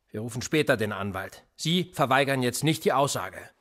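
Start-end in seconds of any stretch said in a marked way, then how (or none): none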